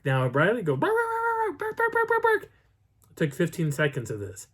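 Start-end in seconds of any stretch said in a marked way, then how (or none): 0.8-2.4 high, rapid-fire barks; loud high-pitched yapping; imitated dog barking. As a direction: mimic a frantic dog barking. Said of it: "Riley starts to bark".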